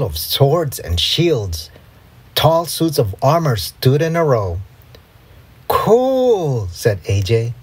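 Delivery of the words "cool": excited. Acted. AJ sees swords and armor.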